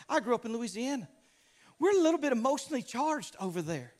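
ordinary talk

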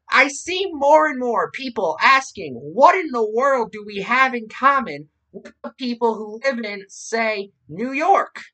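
high, loud mocking radio-host voice; Rush Limbaugh parody. Podcast host reading out a radio host's transcript, mocking how he speaks. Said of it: "I am making fun of".

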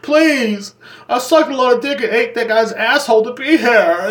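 high-pitched, desperate begging; hammed-up tearful plea; pleading contestant. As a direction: beg in a put-on high voice, drawing it out. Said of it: "She's like".

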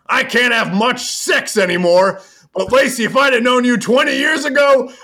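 high, loud hammed-up boast, building to a whoop; Rick Flair. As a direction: holler it as a wrestler impression, ending on a big whoop. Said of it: "And Rick goes".

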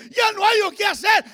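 loud, high preacher's cry of desperation; acted desperation at full preaching force; desperate outcry. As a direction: cry it out as if at wits' end. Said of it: "desesperadamente dice".

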